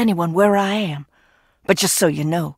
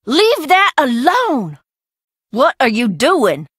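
higher, slow alarmed shout; sharp warning; 3 semitones higher than usual. Real, not acted. Catching the visitor touching her things, alarmed shout. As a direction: stop her hands with your voice.

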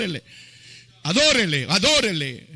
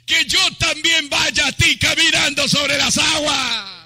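high, loud cry building to full force; shouted plea; 8 semitones higher, 7 dB louder than usual. Retold or imitated. Peter challenges Jesus from the boat, shouting at full voice. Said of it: "Y le gritaba".

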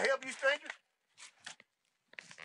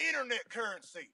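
normal talking voice

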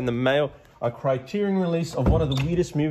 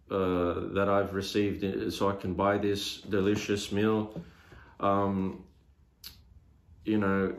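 low, subdued prayer; saying grace; 5 semitones lower, 4 dB quieter than usual. Real, not acted. Man thanks the Lord before his meal, subdued prayer. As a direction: pray sincerely in a low, even voice.

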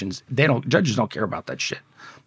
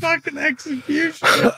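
much higher, laughing through the word; squeaky laughing disbelief. Real, not acted. Host asks if he was scared, a high laughing squeak. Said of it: "You shitting yourself?".

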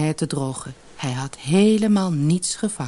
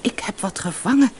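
pleased, higher-pitched discovery; happy; 6 semitones higher than usual. A narrator's reading. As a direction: glad fisherman thinking aloud. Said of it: "dacht de visser blij".

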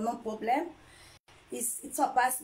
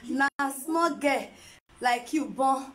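ordinary delivery

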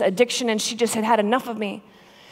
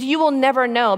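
louder emphatic; 6 semitones higher, 5 dB louder than usual. Retold or imitated. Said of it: "And she's".

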